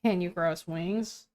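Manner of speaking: low, soft, dry wry tone; deadpan it quietly and low. Shown as a demonstration. Partner correcting the reader's line, wry tone. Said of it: "Wry tone is what I always do".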